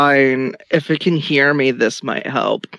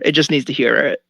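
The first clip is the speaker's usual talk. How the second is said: usual speaking voice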